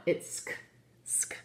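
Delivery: sk said as a bare unvoiced sk cluster, no vowel; sk sound. Shown as a demonstration. Teacher showing pupils how SCH sounds, whispered sk. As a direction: breathe out sk, just the two sounds sk. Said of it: "S C H becomes sk".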